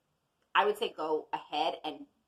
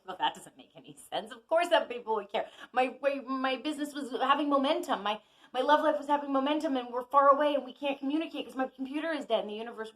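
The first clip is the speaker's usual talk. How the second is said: higher, rising complaint that speeds up at the end; mock frustrated protest; 7 semitones higher, 3 dB louder than usual. You argue with the universe, mock frustrated protest. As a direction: play the stubborn person arguing back at the universe. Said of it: "And you're like".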